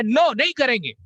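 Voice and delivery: loud, high flat no; loud defiant no; blunt refusal. Retold or imitated. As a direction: bark out a refusal. Aggressive refusal acted out.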